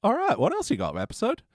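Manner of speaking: high, eager call-out to the episode; mock eager challenge. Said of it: "It was right at the bit as well".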